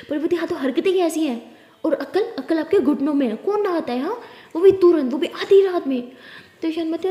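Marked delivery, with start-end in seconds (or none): 0.3-6.1 exasperated telling-off, higher and louder towards the end; scolding. Acted. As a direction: scold her, hammering the last phrases. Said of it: "डांटती रहोगी". (झुंझलाकर ज़ोर से डांटते हुए)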